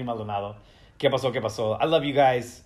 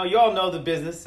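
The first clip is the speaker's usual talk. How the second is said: ordinary talk, nothing marked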